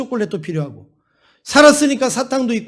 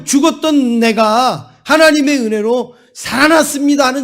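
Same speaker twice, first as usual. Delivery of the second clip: preaching at full force; 3 semitones higher, 5 dB louder than usual. Acted. Pastor urging his congregation to be grateful, in full-force preaching. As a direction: press every line on the congregation. Preacher drives home the lesson.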